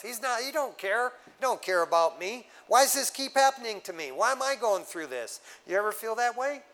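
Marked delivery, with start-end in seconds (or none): 0.0-5.4 higher, whiny voicing of a frustrated believer; mock complaint. Preacher imitating a believer who feels God doesn't care, higher and whining. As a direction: complain like you feel abandoned by God.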